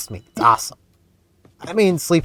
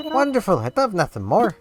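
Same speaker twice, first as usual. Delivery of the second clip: higher Irish curator voice; Blathers voice; 10 semitones higher than usual. Pretend. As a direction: lecture the facts as an Irish curator. Reads Blathers' catfish facts.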